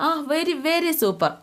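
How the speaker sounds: put-on a boy thrilled with his party, answering mum; gushing child. Son gushes about birthday party. (അമ്മയോട് ആവേശത്തോടെ, ഉയർന്ന കുട്ടിസ്വരത്തിൽ)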